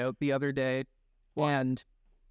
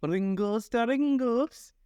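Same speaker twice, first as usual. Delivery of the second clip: mock ad voice; 8 semitones higher, slower than usual. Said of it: "I was just like".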